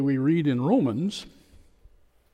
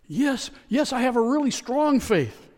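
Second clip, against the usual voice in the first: much higher, eager insistent reply; 10 semitones higher, 3 dB louder than usual. Retold or imitated. Dangling tourist assuring the voice, eager. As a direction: rush to prove your faith.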